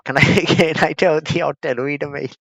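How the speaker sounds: ordinary talk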